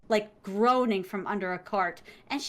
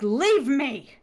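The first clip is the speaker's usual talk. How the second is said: louder, commanding repeat of Leave me; firm order; 6 dB louder than usual. Retold or imitated. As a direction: insist on it as an order. Prince repeats his order.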